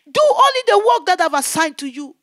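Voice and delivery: the Lord giving her the assignment, loud. Retold or imitated. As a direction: lay down the charge firmly. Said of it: "He said, "My daughter".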